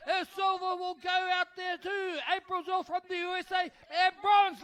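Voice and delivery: rising to a full shout at the finish line; excited race call. Real, not acted. Race finish, caller yelling home.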